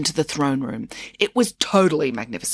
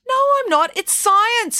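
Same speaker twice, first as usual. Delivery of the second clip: much higher and louder, insistent; exclaiming; 4 dB louder than usual. Real, not acted. Mum insisting to her daughter, high and emphatic. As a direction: fire it back, certain. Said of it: "exclaimed Mum".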